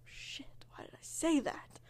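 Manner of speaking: hushed panicked curse under breath; whispered self-scolding. Retold or imitated. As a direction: whisper it to yourself in horror. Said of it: "and then was like".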